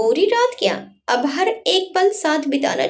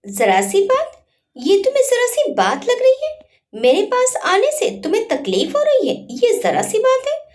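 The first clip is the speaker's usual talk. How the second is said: higher, indignant rising questions; irritated; 8 semitones higher than usual. Acted. Wife irritably repeats husband's words back to him. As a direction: throw his words back at him, offended. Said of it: "चांदनी चिढ़ी हुई बोली".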